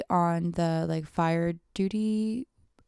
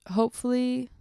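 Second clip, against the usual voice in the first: usual speaking voice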